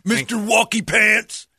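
high shouted mock taunt. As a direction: yell it high like a taunting beggar. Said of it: "aimed it at me like".

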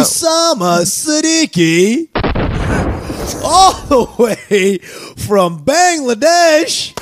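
high, slow, drawn-out ring announcer. Pretend. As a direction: stretch every name like a big intro. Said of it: "that was amazing".

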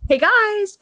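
high, loud hello to listeners; bright greeting. Real, not acted. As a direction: sing out the hello. Greeting the podcast audience.

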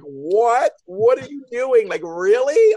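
high, loud, incredulous questions; what on earth are you doing; exasperated disbelief. Retold or imitated. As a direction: stretch it out in loud, rising disbelief. Exasperated reaction to the storyline.

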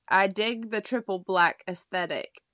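ordinary talk, nothing marked

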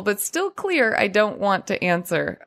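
normal talking voice

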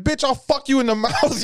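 high-pitched insult cracking into laughter; hammed-up fighting talk; mock angry outburst. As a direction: fire back high, then break up laughing. Retold heated insult in a fight.

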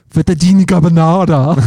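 hammed-up Italian menu reading; mock Italian accent. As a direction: overdo the Italian accent, trying to sound legit. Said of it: "try and sound legit".

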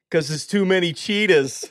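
put-on punchline in a Boston accent, laughing; hammed-up Boston punchline. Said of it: "doing the Boston accent".

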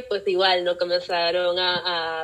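ordinary talk, nothing marked

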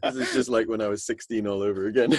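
talking through laughter; laughing. Real, not acted. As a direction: laugh while saying it. Laughing at immature joke.

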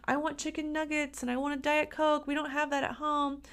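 high-pitched mock whining child; kid throwing a fit. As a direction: plead and pile up demands like a kid, without shouting. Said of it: "that kid kicks and screams and says".

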